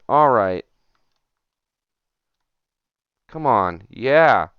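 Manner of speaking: loud, pumped-up yell of victory; triumphant cheer. Real, not acted. Gamer hits the move at last, loud triumphant cheer. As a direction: celebrate the landed hook out loud.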